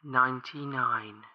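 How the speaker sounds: slow, soft, even count; creepy measured countdown; calm and precise. A narrator's reading. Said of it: "said calmly and precisely".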